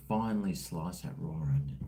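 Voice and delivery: softly whispered, lingering on each word; savouring whisper. Real, not acted. To his stream, whispering lovingly about sliced raw onion. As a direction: whisper it like you can taste it.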